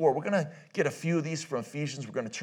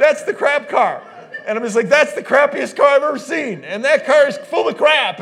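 loud, high, laughing mock yell; real laughter, shouted at the car; 12 semitones higher, 16 dB louder than usual. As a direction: howl it out while cracking up. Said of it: "So I can't stop laughing".

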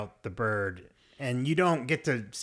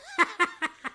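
squealing burst of laughter; high laugh. Real, not acted. Laughing at assistant scene.

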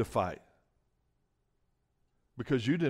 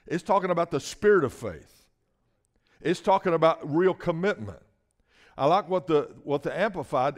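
loud, fervent preaching, pushing each phrase; full-force preaching; 2 semitones higher, 4 dB louder than usual. Acted. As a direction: drive it home like a revival preacher.

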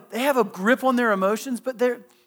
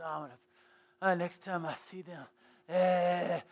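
much softer, low muttered threat under the breath; muttered grudge; 4 semitones lower, 12 dB quieter than usual. Retold or imitated. You lying awake fuming at who humiliated you, softly muttered. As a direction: grumble a half-formed revenge into the pillow. Listener stewing in bed, voiced.

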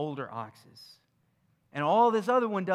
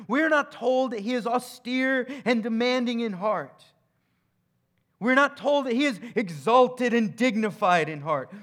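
preaching build; 6 semitones higher, 8 dB louder than usual. Real, not acted. Rising preaching emphasis.

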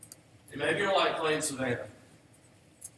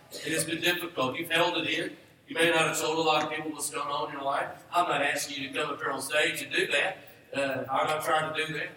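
usual speaking voice

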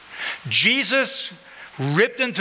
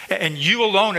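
you alone pressed home with emphasis; emphatic YOU; 4 semitones higher, 3 dB louder than usual. Real, not acted. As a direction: punch you, then alone. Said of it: "it's emphatic".

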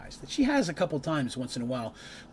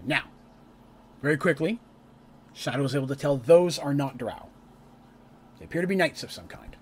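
ordinary talk, nothing marked

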